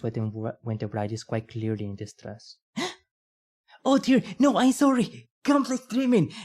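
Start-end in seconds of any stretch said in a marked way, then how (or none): 3.8-6.3 startled. Real, not acted. Startled by a gasp, apologizing.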